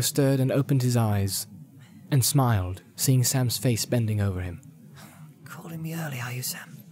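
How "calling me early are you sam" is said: soft drowsy Frodo; sleepy whisper. Acted. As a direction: murmur it half asleep. Frodo just woken.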